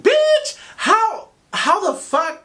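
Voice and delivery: very high, loud, incredulous shriek; shocked outburst. Retold or imitated. Woman retelling her reply to hookup working at theme park, shrieking. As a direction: burst out in high-pitched disbelief.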